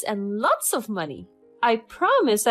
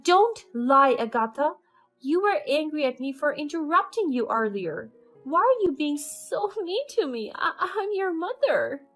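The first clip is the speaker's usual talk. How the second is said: fake crying; 7 semitones higher, 6 dB louder than usual. A narrator's reading. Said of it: "she cried in the middle of her statement".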